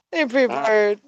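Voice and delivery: ordinary talk, nothing marked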